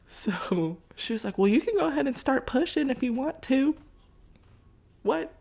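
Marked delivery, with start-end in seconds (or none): 1.4-5.3 much higher and louder, startled; shocked. Retold or imitated. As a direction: react as if you can't believe your ears. Doctor's offer, then shock.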